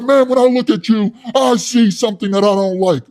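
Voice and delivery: high, loud, puffed-up yelling; loud hothead Wes. Retold or imitated. Wes scolding his soy boy cellmate, loud and high. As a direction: rant like a tough guy with something to prove.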